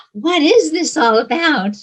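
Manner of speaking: high, loud, incredulous questioning; a skeptical inner voice challenging the speaker; inner doubting voice. Retold or imitated. The inner voice questions the preacher, high and loud. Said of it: "a little voice here going".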